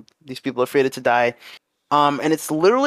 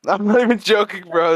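laughing; 6 semitones higher, 4 dB louder than usual. Real, not acted. Laughs while insisting it's true.

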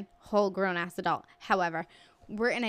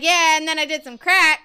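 much louder, higher booming imitation; blaring loud; 7 semitones higher, 12 dB louder than usual. Friend confessing crack at a party, blaring loud. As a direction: blurt it out so the whole pizza place hears. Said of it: "blow your eardrums out talking about crack".

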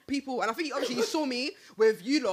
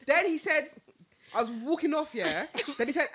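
ordinary delivery

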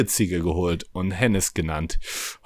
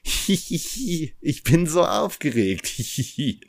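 giggly sneering imp; 5 semitones higher than usual. Acted. Excited diary giggling.

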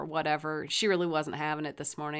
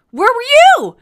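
very loud, high-pitched retort; indignant shout; 20 dB louder, slower than usual. Retold or imitated. Son looking at the parent, throwing blame back in a shout. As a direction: imitate a kid yelling the accusation back.

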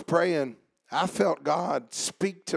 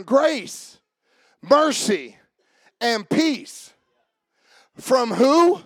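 raised, forceful pulpit voice with punched key words; impassioned preaching; 11 semitones higher, 7 dB louder than usual. Real, not acted. Preacher urges tired and weak believers toward grace, impassioned preaching. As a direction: preach it out strongly, letting phrases ring.

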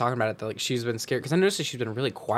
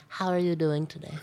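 put-on voice; 3 semitones higher than usual. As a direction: redo the question in a put-on voice. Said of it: "now that you've used that voice".

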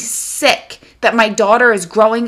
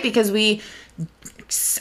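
ordinary talk, nothing marked

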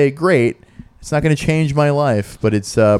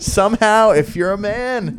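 mocking imitation; 7 semitones higher than usual. Mocking friend's words.

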